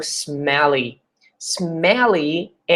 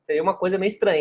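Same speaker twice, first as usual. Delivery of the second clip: ordinary talk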